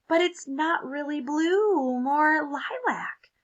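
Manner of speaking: fussy correction. Acted. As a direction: correct them primly. Crayon corrects colour.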